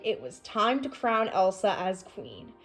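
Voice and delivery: plain reading voice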